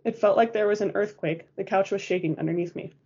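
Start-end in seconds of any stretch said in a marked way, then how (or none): none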